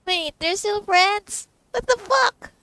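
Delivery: high, loud and slow disbelief; real shock that breaks into a curse; shocked gamer reacting to a reveal. Real, not acted. Shocked at the game drawing.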